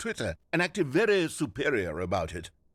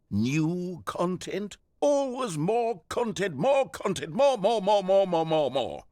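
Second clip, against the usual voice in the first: mocking; 7 semitones higher, 3 dB louder, slower than usual. Narrator mocking content cravings.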